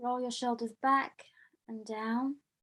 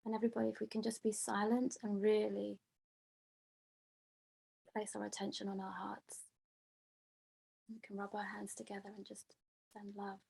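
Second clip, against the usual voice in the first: much softer meditation guidance; guided meditation; 6 dB quieter than usual. Acted. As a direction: gently lead them inward, voice sinking away. Guides silent heart meditation.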